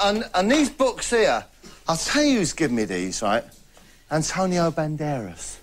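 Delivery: ordinary delivery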